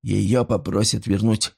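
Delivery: ordinary delivery, nothing marked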